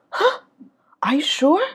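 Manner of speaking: high, loud incredulous check; surprised disbelief. Acted. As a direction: react in disbelief, lifting "sure". Acted reaction to surprising news. (ngạc nhiên, không tin nổi)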